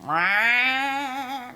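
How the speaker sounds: high, drawn-out siren meow; siren-like cat howl. Retold or imitated. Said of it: "like, siren-y to each other".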